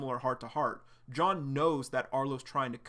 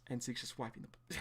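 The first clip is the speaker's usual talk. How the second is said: plain reading voice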